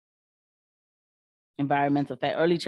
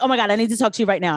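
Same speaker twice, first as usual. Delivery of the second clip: fast, louder, rushed plea; frantic patient; 2 semitones higher, 4 dB louder, much faster than usual. Retold or imitated. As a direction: imitate a needy patient rushing the nurse. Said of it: "she'd be like".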